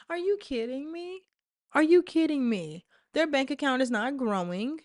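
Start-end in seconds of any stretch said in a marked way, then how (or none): 0.1-2.8 higher, then louder disbelief; really can't believe it; are you kidding me, in rising disbelief; incredulous. Real, not acted. Incredulous outburst.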